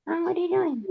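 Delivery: high, soft pet-talk voice; high hushed baby talk; cat baby talk. Pretend.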